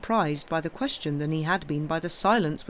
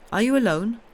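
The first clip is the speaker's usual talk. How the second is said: put-on louder, higher question in an Italian accent; 6 semitones higher, 6 dB louder than usual. A narrator's reading. Priest questioning his host, Italian accent. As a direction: ask pointedly with an Italian accent. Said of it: "with a very heavy Italian accent".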